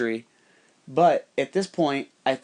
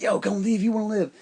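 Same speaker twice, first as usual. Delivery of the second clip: higher put-on voice, lightly urgent; retold heads-up, pitched up; Wendell's urgent warning; 8 semitones higher than usual. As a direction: imitate a guy popping up to warn them.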